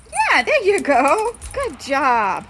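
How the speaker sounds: loud, high opening, drawn out; excited praise. Real, not acted. Owner praising dog for going out, loud and high. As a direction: cheer the dog on loudly.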